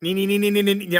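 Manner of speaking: high, quick repeated nee nee nee; imitated panel beeping. Shown as a demonstration. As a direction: mimic the whiny alarm noise of the panel. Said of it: "it makes an awful noise".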